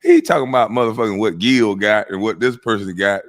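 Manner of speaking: usual speaking voice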